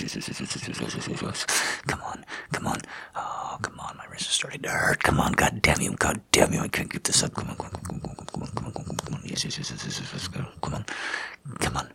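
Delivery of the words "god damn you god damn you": usual speaking voice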